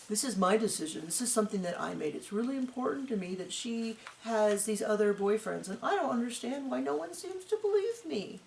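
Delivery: a quiet, mopey imitation of the husband; imitating Mike. Mocking the husband on TV, soft and mopey. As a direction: mimic him softly and glumly, like a defeated man. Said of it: "This is how he sounds".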